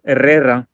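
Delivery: Herrera with a rolled double R; rolled R's. Shown as a demonstration. Showing how Dan's last name is pronounced, Herrera with rolled R's. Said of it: "it's supposed to be pronounced".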